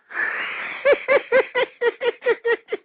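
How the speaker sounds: sudden high, shocked laugh; high burst of laughter. Real, not acted. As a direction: cracks up at himself. Shocked at his own insult.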